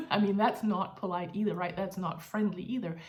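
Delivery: ordinary talk, nothing marked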